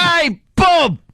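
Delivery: high English-accented echoing shout; mock English greeting. Shown as a demonstration. Co-host echoing the greeting for the intern, high mock-English shout. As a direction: echo the cheer in cartoon English accent. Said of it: "you gotta go".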